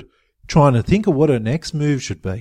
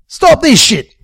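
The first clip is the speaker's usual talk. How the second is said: raised, high-pitched command; yelling; 10 semitones higher, 6 dB louder, slower than usual. A narrator's reading. Jimbo orders the men to stop fighting, yelling. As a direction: yell to break up a fight. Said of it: "Jimbo yelled".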